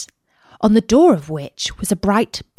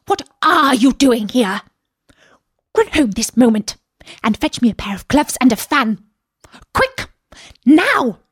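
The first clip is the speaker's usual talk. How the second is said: higher, impatient commanding rabbit; angry rabbit; 4 semitones higher than usual. A narrator's reading. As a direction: order her off crossly. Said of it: "called out to her in an angry tone".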